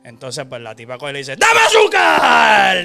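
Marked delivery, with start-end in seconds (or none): 1.4-2.9 sudden very high, very loud shriek; jump-scare the listener with a shriek; ghost scream. Retold or imitated. Ghost girl screams at the neighbour, piercing shriek.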